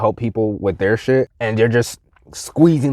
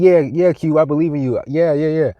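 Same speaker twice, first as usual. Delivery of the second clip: higher-pitched, quick placating agreement; mock encouragement; 7 semitones higher, 3 dB louder than usual. Everybody claims to believe in him, mimicked as higher-pitched placating. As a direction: imitate people humoring you.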